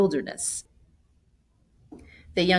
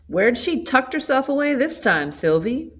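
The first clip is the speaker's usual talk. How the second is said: put-on higher, louder old country woman; old grandmother calling; 8 semitones higher, 4 dB louder than usual. A narrator's reading. Grandmother calling to Sylvie in the doorway, higher. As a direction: call out like a scolding granny.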